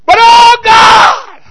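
high, loud preacher's cry; preaching shout. Real, not acted. Pastor rousing the congregation, shouting high and loud. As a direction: erupt into a high roar from the pulpit. Preacher erupts at full force.

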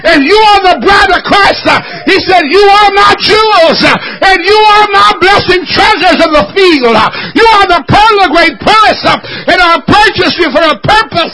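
loud, high-pitched whooping sermon delivery; fervent preacher crying out to the church; full-force preaching. Acted. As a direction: preach it out with everything you've got.

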